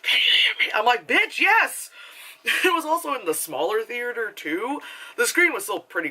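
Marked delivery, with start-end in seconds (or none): 0.0-0.7 movie whisper. Acted. Couple whispering to each other in a theater, movie whisper. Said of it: "it was, like, movie whispering". 1.1-1.9 high, emphatic comeback; mock exasperated retort. Moviegoer answering the whispering couple, mock exasperated.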